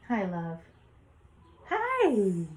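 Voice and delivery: high, loud sing-out on the last hi; affectionate greeting. Real, not acted. Parent breaks off the story to greet a child, affectionate and high. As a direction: greet a little one, pitch leaping up.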